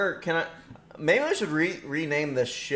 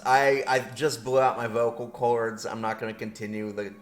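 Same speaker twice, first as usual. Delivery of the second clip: ordinary talk, nothing marked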